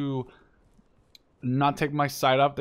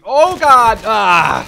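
loud sudden cry of alarm, pitch jumping up; real shock mid-fight; startled yell; 7 semitones higher, 15 dB louder, much slower than usual. Real, not acted. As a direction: caught off guard by enemy fire, yelp it out. Startled in a firefight.

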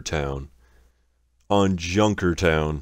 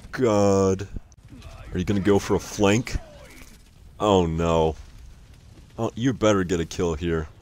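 usual speaking voice

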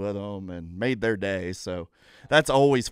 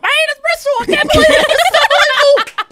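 much higher, louder, squealing with laughter; shrieking high excited Dale; super high-pitched; 15 dB louder than usual. Retold or imitated. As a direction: squeal like a driver who just won. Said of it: "he's super high-pitched".

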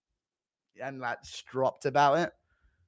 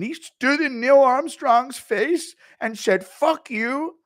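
1 [name] mock voice; 12 semitones higher, 4 dB louder, slower than usual.

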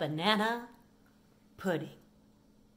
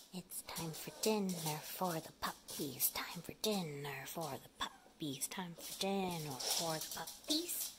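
soft whisper call; 8 semitones lower, 11 dB quieter than usual. Real, not acted. Calling dogs quietly.

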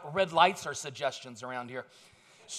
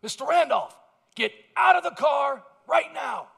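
cop shouting; 8 semitones higher, 9 dB louder than usual. Retold or imitated. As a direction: bark it like a cop over a loudspeaker. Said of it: "I hear from his car".

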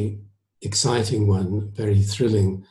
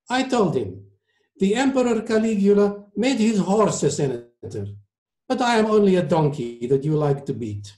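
put-on high-pitched, emphatic Italian accent; 10 semitones higher than usual. A narrator's reading. Maestro tells diners of his beating, emphatic Italian accent. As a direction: testify in a thick Italian accent. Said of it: "in his heavy Italian accent".

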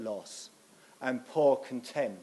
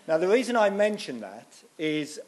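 projected louder for the back of the room; speaking up; 5 semitones higher, 6 dB louder than usual. Real, not acted. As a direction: push the voice out so all can hear. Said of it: "can you hear me now?".

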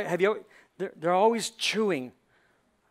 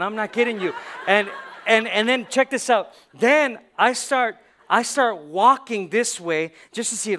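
laughing excitedly; 4 semitones higher, 7 dB louder than usual. Real, not acted. Laughing, hyped about cows.

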